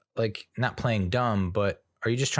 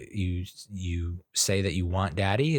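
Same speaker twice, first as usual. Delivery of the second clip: usual speaking voice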